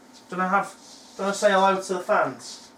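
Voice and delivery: ordinary talk, nothing marked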